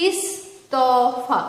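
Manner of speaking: ইস্তফা slowly, split into its syllables; syllable-by-syllable pronunciation. Shown as a demonstration. Teacher giving an example of marked pronunciation, syllable by syllable. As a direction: draw out each part of ইস্তফা. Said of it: "উচ্চারণ নির্দেশ".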